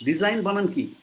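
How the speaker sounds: ordinary talk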